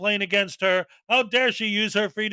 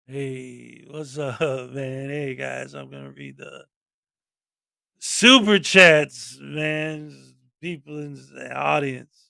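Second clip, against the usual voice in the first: Tommy Chong; 9 semitones lower, slower than usual. Acted. Host re-enacting his show opening, stoner impression. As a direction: drop it deep and lazy, like a stoner. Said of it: "in my Tommy Chong voice".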